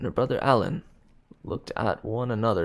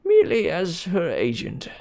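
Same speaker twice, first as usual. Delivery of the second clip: louder, higher, pressed; sharp emphasis; 8 semitones higher, 4 dB louder than usual. A narrator's reading. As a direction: lean hard on the words. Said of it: "he emphasized sharply".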